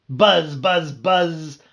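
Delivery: very loud buzz; loud shout. Acted. Bee shouting buzz into a sleeper's ear. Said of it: "I shouted as loudly as I could".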